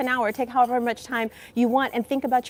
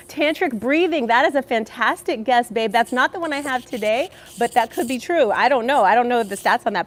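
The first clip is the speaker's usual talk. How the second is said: usual speaking voice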